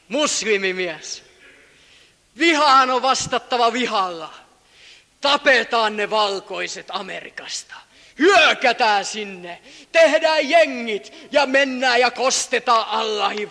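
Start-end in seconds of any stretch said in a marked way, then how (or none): 2.4-13.5 militant rant. Retold or imitated.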